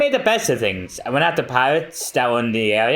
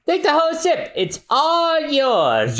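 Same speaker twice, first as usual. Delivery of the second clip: mocking parting; 13 semitones higher than usual. Nami sails off taunting.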